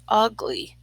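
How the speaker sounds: ordinary delivery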